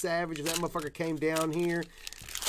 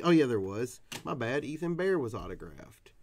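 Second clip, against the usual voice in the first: ordinary talk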